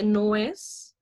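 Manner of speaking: "no es" drawn out slowly; sinalefa demo. Shown as a demonstration. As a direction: slowly show "no es" as one linked unit. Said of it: "sería una sinalefa".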